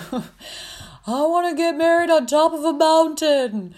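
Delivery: high, slow, drawn-out quote; exaggerated high movie-quote voice; Anchorman movie line. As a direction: wail it slowly like a hammed-up film line. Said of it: "if you guys have ever seen the movie Anchorman".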